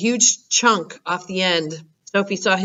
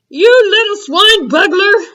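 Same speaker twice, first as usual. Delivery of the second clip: much higher, louder furious giant; furious roar; 12 semitones higher, 9 dB louder than usual. Acted. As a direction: roar the insult. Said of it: "roared the Blood Bottler".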